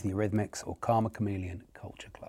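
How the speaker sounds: fading to whisper. Real, not acted. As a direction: let the options fade down into a secret whisper. Quizmaster teasing answer options.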